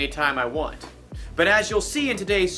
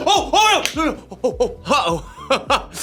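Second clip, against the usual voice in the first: laughing; 9 semitones higher, 4 dB louder, much slower than usual. Real, not acted. Laughing after hero yells.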